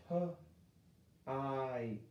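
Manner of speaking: letters "P-I-T" stretched apart slowly; sounding out. Shown as a demonstration. Teacher testing long I on a word, slow sounding out. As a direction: sound each letter out separately. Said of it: "Let's go to this one".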